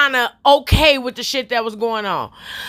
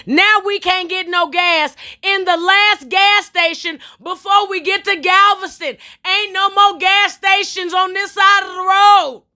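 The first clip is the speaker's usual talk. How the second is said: exasperated rant; 7 semitones higher, slower than usual. Real, not acted. Ranting about the gas fiasco.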